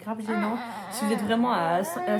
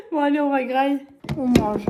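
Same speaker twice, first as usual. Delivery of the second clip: laughing; 2 semitones higher, 8 dB louder than usual. Real, not acted. Laughing with the baby.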